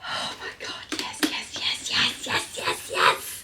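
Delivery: breathless yes yes whispered; hushed ecstatic yeses. Real, not acted. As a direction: whisper in rapture. Thrilled by the bag.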